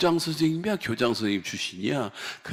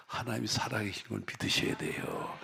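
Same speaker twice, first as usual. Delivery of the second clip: normal talking voice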